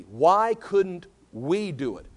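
very slow question with "we" set apart and emphasized; deliberate, spaced-out emphasis on "we"; stress on "we". Shown as a demonstration. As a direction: draw it out and land on "we". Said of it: "the emphatic in the Greek is the word "we".

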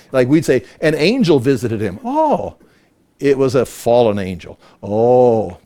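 ordinary talk, nothing marked

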